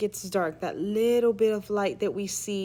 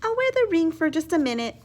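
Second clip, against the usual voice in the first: put-on higher, quicker child's voice; girl talking herself into it; 7 semitones higher, 4 dB louder, faster than usual. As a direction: rush it like a kid sneaking something. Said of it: "she said to herself".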